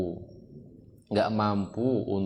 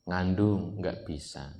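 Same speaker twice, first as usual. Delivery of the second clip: usual speaking voice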